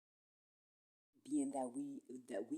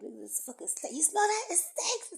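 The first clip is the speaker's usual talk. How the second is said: high, loud cry of disgust; 13 semitones higher, 9 dB louder than usual. Retold or imitated. Reacting to a smelly street, loud disgusted shout. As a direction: yell about the stink. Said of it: "So I'm like".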